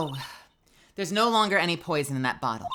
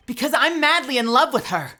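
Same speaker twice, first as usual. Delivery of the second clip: loud, higher heartfelt blurt; impassioned declaration; 8 semitones higher, 7 dB louder than usual. Acted. Lovestruck defendant declares love in court, loud and fervent. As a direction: confess it to the whole court.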